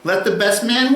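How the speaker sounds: mock capitalist. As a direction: toss it off like a confident capitalist. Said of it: "Capitalists would say".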